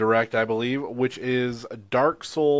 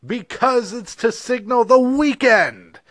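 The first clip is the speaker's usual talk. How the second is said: excited; 10 semitones higher, 4 dB louder than usual. Acted. Excited joke punchline.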